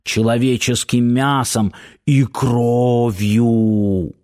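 slow, hushed and spooky; sinister whisper. A narrator's reading. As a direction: draw it out like a ghost story. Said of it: "зловещим шёпотом произнесла она".

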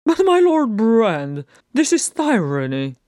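high, loud protest of an outraged courtier; spluttering. A narrator's reading. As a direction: splutter indignantly at the king, starting high and loud. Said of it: "spluttered a courtier".

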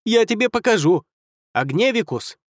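furious high threat then spell; angry spellcasting. Acted. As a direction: hurl the threat and the fire spell. Wizard casting a fire spell.

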